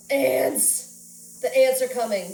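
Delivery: loud, panicky cry of alarm; mock-panicked warning shout. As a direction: yell it like the house is being overrun. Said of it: "And I was just like".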